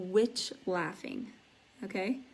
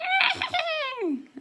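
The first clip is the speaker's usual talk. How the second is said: very high, loud screech; 6 dB louder than usual. Shown as a demonstration. Teacher showing students what a screech is, high loud shriek. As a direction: let out a piercing demonstration screech. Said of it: "a screech is like".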